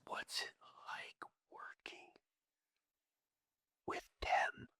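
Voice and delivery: unvoiced, very soft menacing whisper; hateful whisper. The football star whispering with hate. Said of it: "with pure hate in his eyes".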